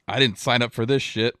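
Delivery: loudly fed up, acting a fleeing crewman; indignant outburst. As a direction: blurt it out in loud indignation. Game master voices fleeing crewman.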